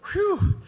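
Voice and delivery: a high, breezy whew of relief; a cheerful sigh of relief, squeaked up high; relieved whew. Retold or imitated. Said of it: "the strict Calvinist gets up and goes".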